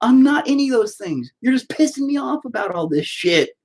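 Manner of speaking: high, fed-up outburst; exasperated retort. Retold or imitated. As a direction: push back hard at the accusers. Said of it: "And it's like".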